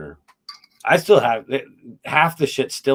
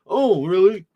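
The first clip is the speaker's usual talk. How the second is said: imitating a fan, pitched higher and louder in surprise; mock surprised fan; 9 semitones higher, 8 dB louder, slower than usual. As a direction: play a stranger suddenly impressed. A fan reacting in a retold exchange.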